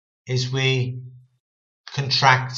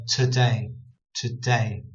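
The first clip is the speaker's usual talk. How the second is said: "today" stressed on DAY, first vowel dulled; 2 semitones lower, slower than usual. Shown as a demonstration. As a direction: lean on the second syllable of "today". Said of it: "putting the emphasis on day".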